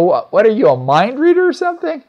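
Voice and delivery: astonished question that leaps high on mind reader; amazed. Retold or imitated. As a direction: sound floored that he guessed it. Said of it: "people's eyes would get real big".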